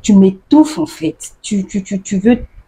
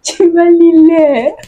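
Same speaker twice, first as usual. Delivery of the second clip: high mock-threat, louder, wailing; threatening taunt; 10 semitones higher, 5 dB louder than usual. To someone in a quarrel, a mock heated threat. Angry Ivorian in a dispute.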